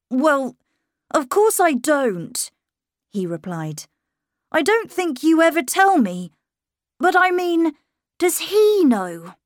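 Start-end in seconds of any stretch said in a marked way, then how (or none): 0.1-2.5 put-on high, louder young boy, earnest; a boy insisting he's grown. A narrator's reading. Miles telling his governess he's growing up. 4.5-9.3 put-on high, louder young boy, earnest; a boy insisting he's grown. A narrator's reading. Miles telling his governess he's growing up.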